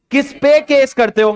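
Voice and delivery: normal talking voice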